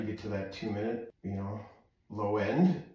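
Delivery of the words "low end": ordinary talk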